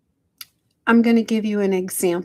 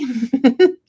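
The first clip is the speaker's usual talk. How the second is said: quick rising laugh; short laugh; 4 semitones higher, 7 dB louder than usual. Real, not acted. Laughing off her mother's guilt trip, short laugh. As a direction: laugh it off lightly.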